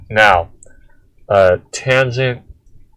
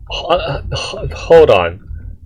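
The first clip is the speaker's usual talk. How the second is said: ordinary talk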